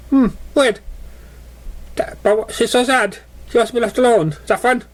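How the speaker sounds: high, puzzled pony voice; puzzled playful pony. Acted. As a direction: wonder aloud like a naive cheerful pony. Memory Her puzzled voice.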